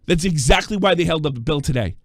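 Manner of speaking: ordinary talk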